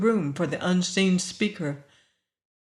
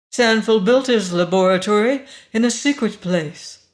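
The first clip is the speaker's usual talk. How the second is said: loud, higher, haughty statement; 4 semitones higher, 7 dB louder than usual. A narrator's reading. Woman tells intruder of the secret laboratory, haughty. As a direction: state it as if above contradiction. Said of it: "The voice was haughty now".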